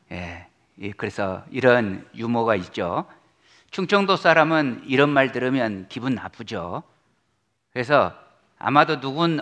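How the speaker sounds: usual speaking voice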